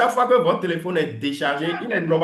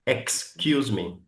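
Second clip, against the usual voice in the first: slow, clearly separated syllables; carefully articulated; 3 semitones lower, 4 dB quieter, much slower than usual. Shown as a demonstration. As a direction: articulate it slowly for the class. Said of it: "Quand vous articulez bien". (bien articulé, lentement)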